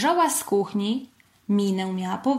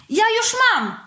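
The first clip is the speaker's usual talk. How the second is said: very high, loud, impatient blurt; angry huff; 14 semitones higher, 5 dB louder than usual. A narrator's reading. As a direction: puff up with childish crossness. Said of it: "sapnął gniewnie Pacyniusz". (gniewne, wysokie sapnięcie)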